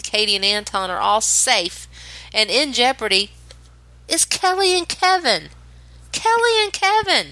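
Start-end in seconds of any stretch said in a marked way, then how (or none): 4.1-5.5 high, announcer-style reveal; dramatic reveal. Acted. 6.1-7.3 even higher, mock-dramatic repeat; dramatic echo. Dramatic results announcement.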